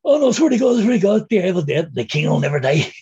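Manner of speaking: high, loud pleading, mock-desperate; a hammed-up frantic insistence; retold pleading protest. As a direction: swear it's true like your life depends on it. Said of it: "and saying".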